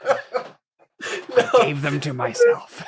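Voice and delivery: high, very slow mock madman; creepy scarred villain. Friend finishing the scar joke, creepy villain.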